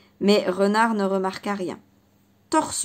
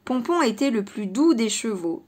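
usual speaking voice

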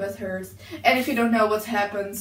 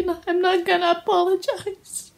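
ordinary talk

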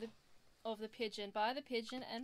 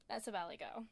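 usual speaking voice

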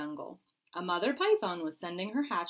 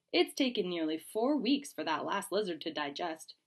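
usual speaking voice

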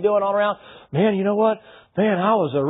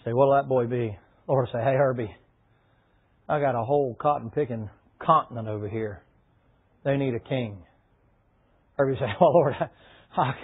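softer, lower, tender telling; hushed preaching; 6 semitones lower, 5 dB quieter than usual. Acted. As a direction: draw them in with a hushed voice. Quiet emotional storytelling.

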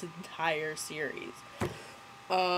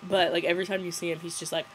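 normal talking voice

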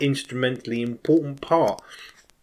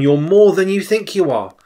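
louder and higher, pressing the words home; raised, insistent repeat of the prophecy; emphatic repeat; 5 semitones higher, 7 dB louder than usual. Retold or imitated. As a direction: say it again with more force. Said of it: "So he says again".

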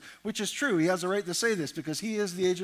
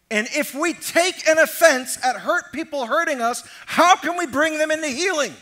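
loud, rising preacher's cadence; preaching at full force; 5 semitones higher, 6 dB louder than usual. Acted. Preacher pressing the congregation on hurt and offense, at full force. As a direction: drive the point home to the congregation.

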